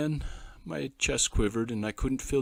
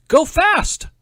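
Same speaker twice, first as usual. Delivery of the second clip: loud high yell of a command; commanding shout; 15 dB louder than usual. A narrator's reading. Boy yelling orders to his toy car, loud. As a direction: shout the order across the garden. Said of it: "I heard him command".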